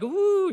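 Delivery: high, loud swooning ooh; mock worship ooh. Professor mocks the chapel hand wavers with a high swooning ooh. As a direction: ham up a rapturous chapel sigh. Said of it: "we got our hand wavers".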